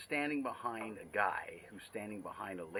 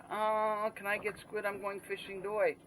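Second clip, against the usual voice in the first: much higher put-on voice, louder at the end; high, eager mock customer voice; mock expat voice; 10 semitones higher, 5 dB louder than usual. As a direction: ham up the pushy foreigner asking. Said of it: "he turns to her and says".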